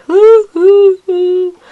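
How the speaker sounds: high, loud hammed-up sobbing hoots; mock sobbing. Child imitating a woman crying into someone's arms. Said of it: "cries into this other person's arms".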